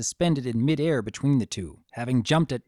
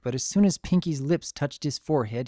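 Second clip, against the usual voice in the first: ordinary delivery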